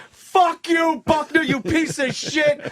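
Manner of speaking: high mock heckler yell, slowing down. Passersby heckle Buckner on the street, high mock yell. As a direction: jeer at him like an angry passerby. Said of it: "people going".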